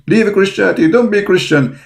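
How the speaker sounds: high, lightly voiced taunt. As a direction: mimic the taunting critics.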